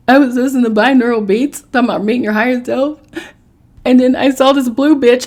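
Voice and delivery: frantic, pouring it all out; panicked. Retold or imitated. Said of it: "That's exactly what I said".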